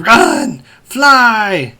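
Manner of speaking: high-pitched mock yell; imitated yelling. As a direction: cry it out like a frantic command. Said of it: "Jimmy starts yelling".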